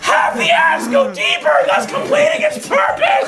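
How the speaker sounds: high, strained mock-heroic yell; battle cry. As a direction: bellow it like a charging hero. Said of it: "What a good battle cry".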